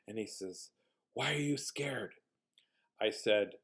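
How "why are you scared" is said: slow, soft whispered question; imitated child whisper. Little boy questioning the scared visitor, whispered. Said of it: "whispers in my ear like this".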